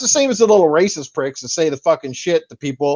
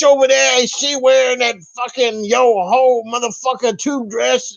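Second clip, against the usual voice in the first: much higher, hammed-up public yelling; mock shouting; 8 semitones higher than usual. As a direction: play a lunatic yelling at strangers on a bus. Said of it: "you'd be shouting out like".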